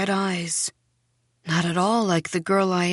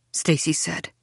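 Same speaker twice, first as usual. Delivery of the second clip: usual speaking voice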